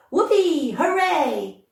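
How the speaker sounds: high whooping cheers; gleeful cheer. Acted. Girl cheering about lifelong gum, high and gleeful. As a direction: whoop it up like a spoilt winner.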